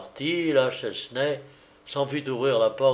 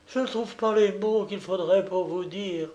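overjoyed; 7 semitones higher than usual. Acted. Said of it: "au comble de l'allégresse".

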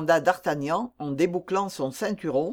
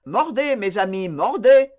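mocking encouragement; 5 dB louder than usual. Captain telling toothache sufferers to bite, mocking encouragement. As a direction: egg them on, pitch leaping on the verb.

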